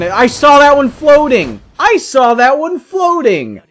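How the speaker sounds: high, loud, drawn-out exclamation; excited shout. Real, not acted. Player calls out a floating tree in the game, high excited shout.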